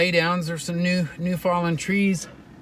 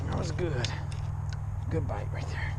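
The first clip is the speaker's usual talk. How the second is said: whispering; 7 dB quieter than usual. Real, not acted. Whispered aside.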